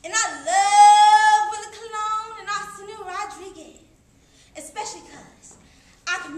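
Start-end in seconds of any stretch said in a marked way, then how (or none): 0.0-3.8 loud, high, with a long-held LOVE; exultant love. Acted. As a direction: sing out your love of the music. Exulting in music she loves.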